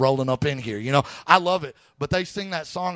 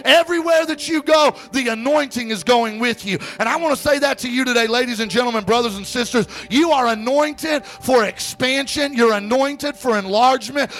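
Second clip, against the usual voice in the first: high, driving, fervent preacher voice; impassioned exhortation, pitched high with punched phrases; full-force preaching; 10 semitones higher, 5 dB louder than usual. Real, not acted. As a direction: build it to a ringing pulpit climax.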